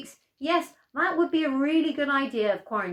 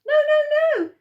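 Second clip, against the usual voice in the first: high, loud pleading no; panicked grandma; 10 dB louder than usual. Acted. Grandma begs the approaching wolf, high and loud. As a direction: squeal it out as the wolf closes in.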